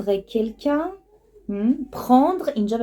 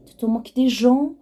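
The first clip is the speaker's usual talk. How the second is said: quick, higher-pitched rising question; brisk accusing question, pitched up; indignant question; 4 semitones higher, faster than usual. Shown as a demonstration. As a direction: challenge them with a rising "تو تو موک دی ژان؟". Phrase as question, then taken slowly.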